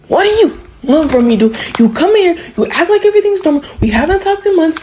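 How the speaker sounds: high-pitched mock indignation; indignant confrontation. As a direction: act out an exasperated confrontation, pitch climbing. Acted confrontation in retold scene.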